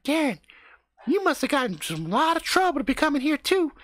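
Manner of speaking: mimicking a girl's affected high chatter; Karen's put-on voice. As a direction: imitate her fake interesting way of talking. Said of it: "really just kinda change her speaking pattern".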